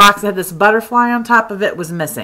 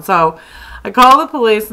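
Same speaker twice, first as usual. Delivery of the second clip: normal talking voice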